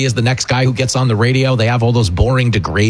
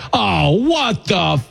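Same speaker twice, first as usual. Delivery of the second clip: slow, drawn-out mock gasp of outrage; mock shocked audience; 6 semitones higher, much slower than usual. Imitating the TV audience reacting to a joke, mock shocked. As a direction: act out a stunned crowd mid-curse. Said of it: "react to it like".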